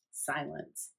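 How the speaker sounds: ordinary delivery, nothing marked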